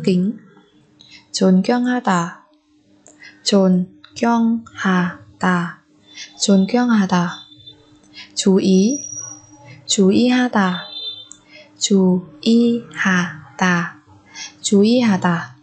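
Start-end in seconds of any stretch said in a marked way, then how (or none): 3.4-5.4 존경하다 in separate beats; syllable by syllable. Shown as a demonstration. Teacher splits word by syllable. 11.8-14.0 slow, spaced chú ý hà tà; syllable by syllable. Shown as a demonstration. Teacher splits word by syllable.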